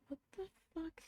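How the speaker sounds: very soft, high-pitched disbelief; whisper-quiet, rattled aside to yourself; freaked out. Retold or imitated. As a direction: imitate being quietly freaked out. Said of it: "being kinda freaked out. freaked out".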